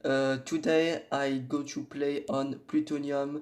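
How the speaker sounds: halting English with a strong French accent; a French speaker stumbling through an English intro; heavy French accent in English. Real, not acted. Gamer greeting viewers in English, heavy French-accented English. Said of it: "mon accent anglais n'est pas ouf".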